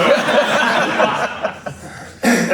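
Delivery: high real laugh, rising; a real laugh going up. Real, not acted. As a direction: let a genuine laugh break out. Laughing at own story.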